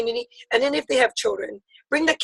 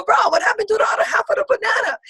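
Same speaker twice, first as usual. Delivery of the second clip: mock disbelief; 9 semitones higher, faster than usual. Auntie ribbing a young surfer in the waves, mock disbelief. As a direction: shout it out like you can't believe it. Scolding surfer about half banana.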